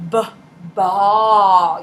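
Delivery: punched, loud B in ball; hard B. Shown as a demonstration. Teacher sounding out for a little kid, exaggerated hard B in "ball". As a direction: hit a hard B to start "ball". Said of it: "You do a really hard B".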